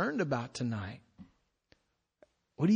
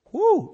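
excited whoop; 11 semitones higher, 7 dB louder than usual. Real, not acted.

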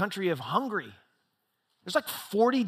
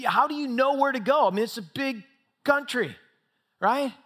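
normal talking voice

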